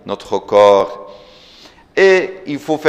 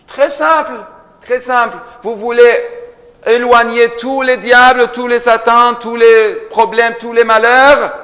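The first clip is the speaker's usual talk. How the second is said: raised, higher preacher's cry, each item hammered; fiery preaching; 11 semitones higher, 5 dB louder than usual. Acted. Preacher urging the faithful to drive out devils, fiery and raised. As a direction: preach it out loud to the whole congregation.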